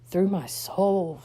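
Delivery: ordinary delivery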